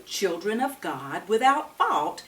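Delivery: normal talking voice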